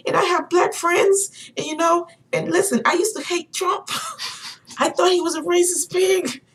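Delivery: very high-pitched worked-up confession; imitating passionate ranter. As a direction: act out an excited man venting. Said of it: "he was so passionate about what he was talking about".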